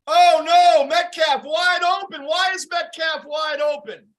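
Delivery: high, loud alarmed play call; real alarm at a wide-open receiver; excited shout. Real, not acted. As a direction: yell it as the receiver breaks free. Reacting live to a big play.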